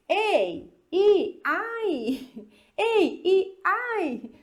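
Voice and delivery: "ei, i, ai" sung up high like a chant; playful vowel chant for students; English vowel names. Shown as a demonstration. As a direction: chant the English A E I with rising pitch. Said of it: "Então pensa assim".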